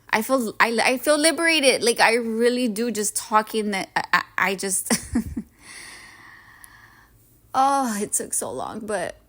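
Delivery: real tears starting to come through; getting emotional. Real, not acted. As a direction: voice rising and choking up, half laughing. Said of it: "Sorry, guys, I'm getting emotional".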